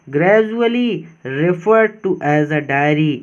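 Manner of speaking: slow, deliberate dictation pace; teacher reading the text slowly for students; slow reading aloud. Shown as a demonstration. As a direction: read each word out slowly and clearly.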